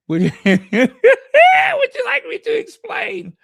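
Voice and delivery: loud laughing question, high and slow. Real, not acted. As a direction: burst out laughing while asking. Laughing at a viewer's comment.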